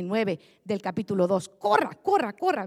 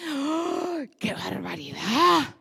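exasperated relative; 3 semitones lower than usual. Retold or imitated. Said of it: "le dice".

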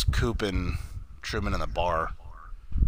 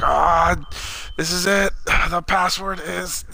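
high, strained dying announcement; hammed-up final gasp, slowing at the end; mock last words; 6 semitones higher, 3 dB louder, slower than usual. Acting out his dying last words.

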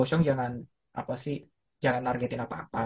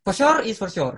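hammed-up imitation; 8 semitones higher, 3 dB louder than usual. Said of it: "mocking Richard's catchphrase".

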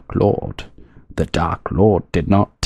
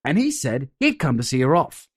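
ordinary delivery, nothing marked

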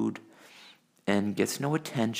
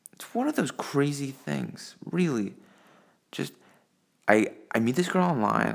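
ordinary delivery

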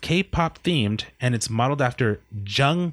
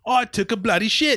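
higher, hammed-up British accent; mock British accent; 8 semitones higher than usual. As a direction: show off a cheeky British accent. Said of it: "does anyone have a good British accent".

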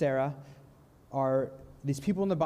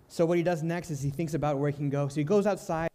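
ordinary talk

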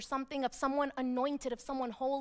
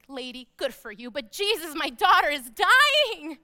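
desperate Jairus; 7 dB louder than usual. Acted. Jairus interrupts about his dying daughter, urgently pleading. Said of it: "I can imagine Jairus standing there like".

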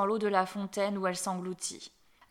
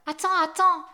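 high, loud call to wait; crying out; 8 semitones higher, 8 dB louder than usual. A narrator's reading. Frog calling after the departing princess, crying out. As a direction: call urgently after someone leaving. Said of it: "cria la grenouille".

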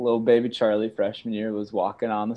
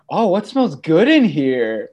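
louder, slow, teasing; teasing quote; 6 semitones higher, 8 dB louder, slower than usual. Retold or imitated. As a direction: drag it out to needle the roommate. Friend teases while staring.

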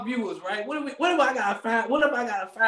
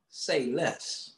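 understated; 6 semitones lower, 8 dB quieter than usual. Retold or imitated. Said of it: "all I can say is".

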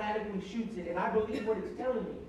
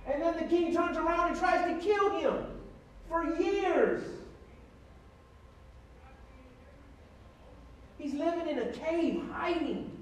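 raised, impassioned preacher's cadence; preaching; 4 semitones higher, 4 dB louder than usual. Acted. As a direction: preach it to the congregation, building. Preaching about King David.

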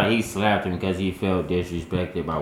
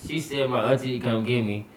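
ordinary delivery

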